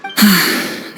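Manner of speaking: a long tired breath out before answering; weary sigh. Acted. Weighing the friend's guess before correcting it, weary sigh. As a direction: let the air go, tired of the question.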